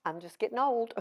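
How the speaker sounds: lightly voiced elderly mother, apologizing; a hint of a frail mother brushing it off; mother shrugging off her memory lapse; apologetic old mother. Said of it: "she kind of apologized and said".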